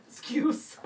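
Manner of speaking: indignant. Retold or imitated. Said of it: "And he's like".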